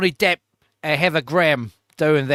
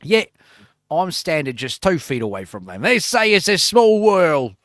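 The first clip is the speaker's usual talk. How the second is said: hammed-up Australian TV host voice; mock Australian host; 3 semitones higher, 5 dB louder than usual. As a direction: do the host with a drifting Australian accent. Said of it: "It just always becomes Australian".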